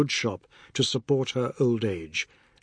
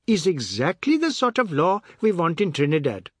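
high, different timbre, eager approval; Chinta's voice; 6 semitones higher, 4 dB louder than usual. A narrator's reading. Chinta agreeing with Oad, eager.

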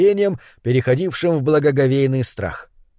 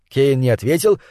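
usual speaking voice

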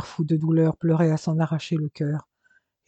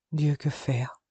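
understated despair, turned inward; subdued, crushed question; devastated; 4 dB quieter, slower than usual. A narrator's reading. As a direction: wonder helplessly to yourself. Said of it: "se demandait Robert anéanti".

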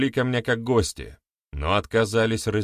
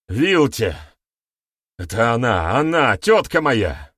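desperate confession; 2 semitones higher, 6 dB louder than usual. A narrator's reading. Terrified youth names his aunt, loud desperate confession. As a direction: spill the name in panic.

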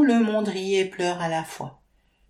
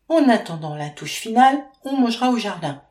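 Maxime's line; 5 semitones higher, 6 dB louder, faster than usual. A narrator's reading. Maxime speaks of the table.

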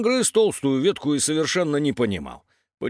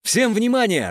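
high-pitched announcement call; calling for attention; 8 semitones higher, 4 dB louder than usual. A narrator's reading. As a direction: summon everyone over the radio. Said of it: "раздался голос Пети". (громко призывает к вниманию)

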